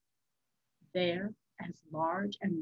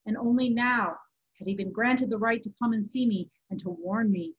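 usual speaking voice

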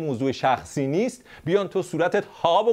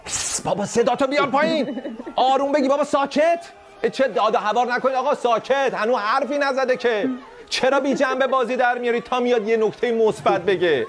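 hushing the crowd; 6 semitones higher than usual. Pretend. Host calming imagined critics with raised hushing voice. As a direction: shout down an unruly room.

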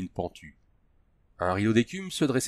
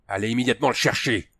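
growling; 2 semitones higher, 3 dB louder than usual. A narrator's reading. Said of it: "grogna Kane".

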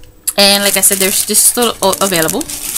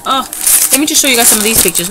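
ordinary delivery

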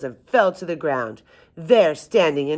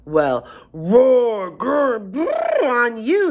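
loud yell climbing higher on each noise; mocking yell; 9 semitones higher, 5 dB louder, much slower than usual. A narrator's reading. Giant yells back at the arguing animals, mocking. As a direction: mimic each animal noise, rising and drawn out. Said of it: "he yelled".

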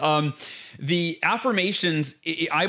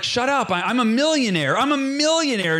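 mock insistence; 6 semitones higher than usual. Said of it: "you're like".

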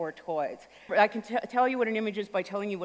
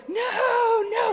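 high squeaky little victim; much higher pleading bacon; tiny high protesting voice; 14 semitones higher than usual. Retold or imitated. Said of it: "he does the bacon going".

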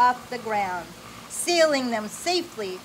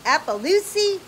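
puzzled question; 7 semitones higher, 6 dB louder than usual. Acted. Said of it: "asked Em".

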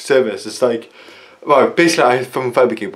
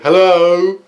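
mock operator hello; 6 semitones higher, 5 dB louder than usual. Mimicking the phone operator.

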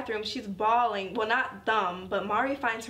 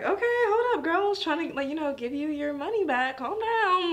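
high sassy comeback; 6 semitones higher than usual. Retold or imitated. Mari tells the rude girl to calm down, high mock sass. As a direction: push back with high sass. Mari answering defensive Shirase.

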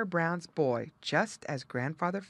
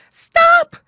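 very high, very loud panicked scream; shriek; 19 dB louder than usual. Acted. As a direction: scream to stop him in time. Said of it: "shrieked Jerry".

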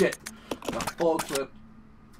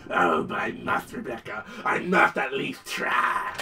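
lower, slow, whispery doomed monster; tragic monster; 6 semitones lower, slower than usual. Retold or imitated. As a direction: plead like a noble beast about to die. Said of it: "Hunter's reply".

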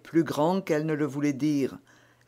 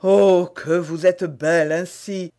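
rising, louder rapture; ardent exclamation; 4 semitones higher, 10 dB louder than usual. A narrator's reading. Duke exclaims his love to the queen, ardent. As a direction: burst out in adoration. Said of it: "dit Buckingham".

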